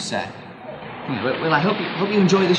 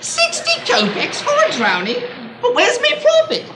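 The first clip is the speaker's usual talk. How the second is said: shocked protest. Acted. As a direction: wail as if robbed of profit. Seller protests low price.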